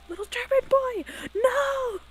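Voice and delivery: high, loud squeaky German character; very high-pitched German impression. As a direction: squeal it like a panicked German cartoon man. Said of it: "high-pitched, like, squeaky German voice".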